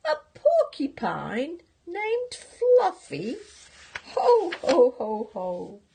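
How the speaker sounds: high, loud, slow laughing disbelief; roaring laughter. Acted. Rhino mocking the porcupine's name, roaring with laughter. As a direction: roar it out with a big ho ho. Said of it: "roared with laughter".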